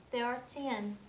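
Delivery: ordinary delivery, nothing marked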